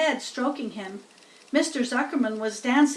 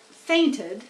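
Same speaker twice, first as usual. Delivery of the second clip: usual speaking voice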